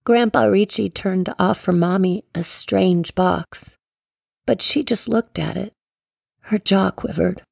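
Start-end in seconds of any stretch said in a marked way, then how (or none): none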